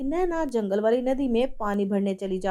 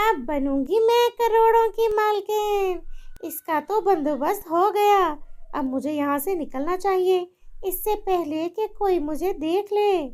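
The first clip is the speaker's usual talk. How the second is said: wicked mother-in-law; 8 semitones higher than usual. Acted. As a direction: gloat over your victory. Villain gloats.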